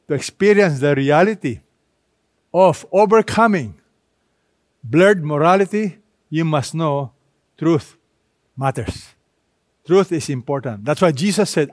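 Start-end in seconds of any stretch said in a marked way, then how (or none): none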